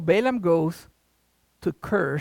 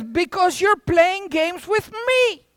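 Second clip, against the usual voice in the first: irritated Balaam; 10 semitones higher, slower than usual. Acted. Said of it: "And he says".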